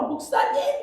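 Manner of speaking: high tearful cry of his name; a weeping deaconess clinging on, crying out. Retold or imitated. Deaconess sobbing as she grabs the pastor, crying out. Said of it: "엉엉 우는 거야".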